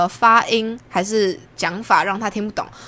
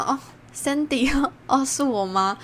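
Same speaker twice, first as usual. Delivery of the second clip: 困惑自问; 5 semitones higher, 4 dB quieter, slower than usual. Retold or imitated. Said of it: "然后我想".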